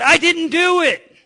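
put-on high, slow, small-child denial; child's denial. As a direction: mimic the little girl pleading innocence. Child denies before dad speaks.

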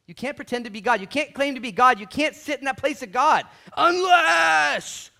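building to a loud, high, slow shout; preaching shout. Acted. Preacher proclaiming only God can claim God's place, shouting.

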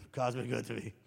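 low, grudging mumble; lightly voiced reluctant mumble. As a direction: drag the words out unwillingly. Sad person repeats reluctantly.

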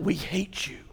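ordinary talk

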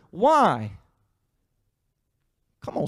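ordinary talk

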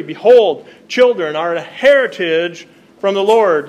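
loud ringing scripture reading; proclaiming loudly. Real, not acted. Pastor proclaims the psalm to the congregation, loudly. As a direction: proclaim it to the whole room.

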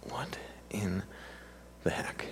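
whispered in disbelief; hushed, stunned reaction to bad news. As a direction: breathe it out, shocked. Stunned by friend's cancer news.

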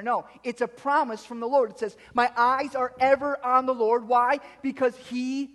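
punchy pulpit delivery with leaned-on key words; emphatic preaching. Real, not acted. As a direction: drive it home to the congregation. Preaches at full force.

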